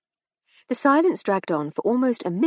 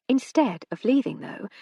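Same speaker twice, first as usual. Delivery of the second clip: ordinary delivery, nothing marked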